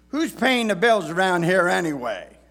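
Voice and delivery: loud, high fed-up father; exasperated dad. Retold or imitated. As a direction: grumble it like a dad. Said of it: "words that many dads have said".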